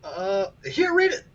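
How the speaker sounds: very high squeaky mouse voice; tiny high-pitched creature blurting a reply; flustered mouse. Acted. GM voices mouse answering Cricket.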